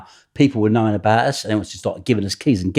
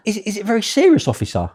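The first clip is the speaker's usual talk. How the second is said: mock concerned manager; 12 semitones higher than usual. [name]'s fake concern to copper.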